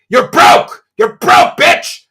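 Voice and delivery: very loud, harsh yelled taunt; furious shout. Real, not acted. Berating a rival over begging for meals, furious shout. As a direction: yell it in the rival's face, full force.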